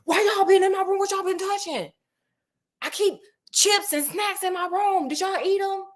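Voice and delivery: high-pitched mock mom scolding; hammed-up high mom voice demanding answers; mom's voice. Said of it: "my mom was like".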